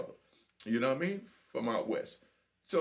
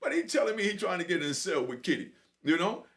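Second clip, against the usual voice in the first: usual speaking voice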